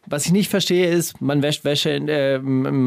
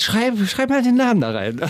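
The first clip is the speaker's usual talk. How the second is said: higher mocking officer voice; mock policewoman; 8 semitones higher than usual. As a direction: ape the cop giving a sly order. Polizistin Kühn nachgeäfft. (Kommissarin Kühn nachgeäfft, höher und spöttisch)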